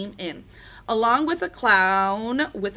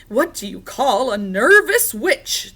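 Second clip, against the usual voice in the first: loud riddling skeleton voice; strange high-pitched voice; 3 semitones higher, 7 dB louder than usual. A narrator's reading. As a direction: pose the riddle loudly, a little oddly. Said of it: "high-pitched voice".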